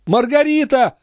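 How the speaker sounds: very high-pitched call for the maid; urgent calling. Acted. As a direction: call out frantically, voice right up. Mother calls maid urgently. (отчаянный оклик очень высоким голосом)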